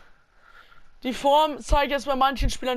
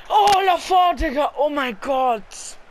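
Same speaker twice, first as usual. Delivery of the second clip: high, loud cry of relief; loud relieved outburst; 4 semitones higher, 6 dB louder, slower than usual. Real, not acted. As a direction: blurt out the shock. Near-conceded goal in match.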